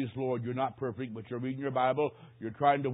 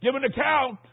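raised, high summons; commanding Son of God; 6 semitones higher, 4 dB louder than usual. Acted. God's Son summons Charlie on judgment day, raised commanding voice. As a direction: call him sternly to judgment. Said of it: "God's Son will say".

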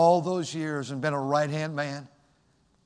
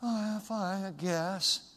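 mock glum answer, much softer; half-hearted reply; 3 semitones higher, 8 dB quieter, slower than usual. Preacher mimics a sufferer asked how they are, half-hearted. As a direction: sigh it out softly as if resigned. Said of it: "it's not".

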